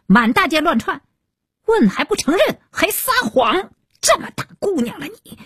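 high-pitched angry mother; shrill-pitched indignant telling-off; scolding at full throat. A narrator's reading. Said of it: "愈发的放开了喉咙".

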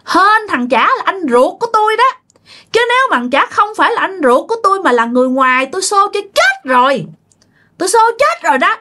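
high-pitched, emphatic ghost voice; menacing spirit, boastful. Retold or imitated. Spirit says it would have killed an outsider, high and menacing. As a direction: threaten in a high, cocky spirit voice.

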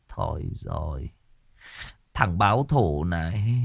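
low, soft and slow; despairing groan. Acted. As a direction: sink into defeat. Boss despairs. (giọng chán nản, thì thào)

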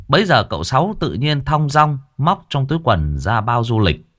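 ordinary delivery, nothing marked

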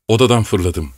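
usual speaking voice

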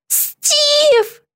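long, high grieving call; anguished moan. A narrator's reading. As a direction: draw the name out in a high moan. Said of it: "простонала Битти". (протяжный горестный стон)

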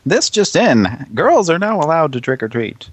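high-pitched, hammed-up announcer; mock news bulletin. Spoofing a Halloween ad, mock announcer. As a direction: proclaim it like a parody newsflash.

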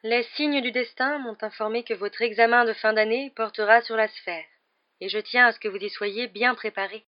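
high, portentous seer's announcement; mysterious professor. Acted. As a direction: proclaim it as a mystic fortune-teller.

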